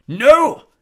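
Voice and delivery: high, very loud growl. A narrator's reading. Hagrid refuses Dumbledore's removal, growling. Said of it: "growled Hagrid".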